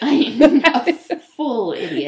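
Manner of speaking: loud goofy dummy voice; mock idiot voice. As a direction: blurt it like a proud fool. Mocking Ahmed's inner voice.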